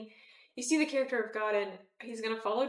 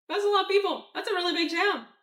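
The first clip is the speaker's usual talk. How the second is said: amazed; 9 semitones higher, 6 dB louder than usual. Real, not acted. Marvelling at city size.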